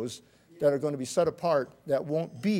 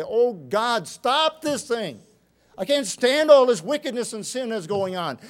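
pleading to God; 5 semitones higher, 6 dB louder than usual. Acted. The faithful crying out to God, pleading. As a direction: cry out to God for it to stop.

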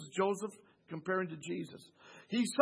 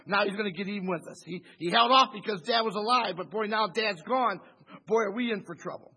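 brothers' dread, louder and hammed-up; mock worried brothers; 7 dB louder than usual. Brothers fearing Joseph's revenge, hammed-up louder worry. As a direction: play the guilty brothers sweating the payback.

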